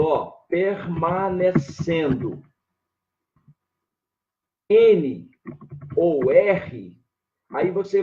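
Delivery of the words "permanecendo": "permanecendo" drawn out syllable by syllable, very slow; slow dictation. Shown as a demonstration. Teacher to class writing it down, very slow dictation. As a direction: spell out "permanecendo" slowly for the class to copy.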